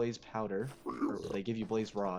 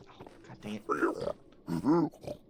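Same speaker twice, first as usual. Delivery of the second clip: frustrated groan; 5 dB louder, much slower than usual. Real, not acted.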